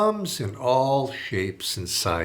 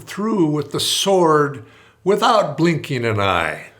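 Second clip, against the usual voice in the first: louder, slow menacing boast; threatening warlord; 5 semitones higher, 8 dB louder, slower than usual. Retold or imitated. Warlord finishing his threat to the priest, loud. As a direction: grind out the boast slowly.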